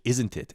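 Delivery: "Isn't it" with every consonant sounded; over-pronounced. Retold or imitated. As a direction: really pronounce "Isn't it", z and t's crisp. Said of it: "he would really pronounce it".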